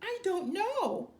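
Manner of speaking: high loud wail. A narrator's reading. Poor mother answering her husband, wailing. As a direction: wail it helplessly. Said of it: "wailed the poor mother".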